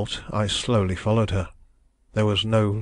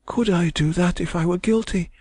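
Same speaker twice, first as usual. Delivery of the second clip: high woman's voice, held back but intense; subdued thrilling tone; 9 semitones higher than usual. A narrator's reading. Young woman at the deathbed pleading innocence, subdued but thrilling. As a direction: plead over the body. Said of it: "in a subdued but thrilling tone".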